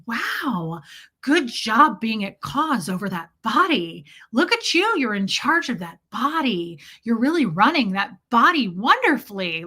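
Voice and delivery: imitated gushing baby talk with big pitch swoops; overdone praise cooed at an infant; Brenda. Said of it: "this is how they would talk to a baby".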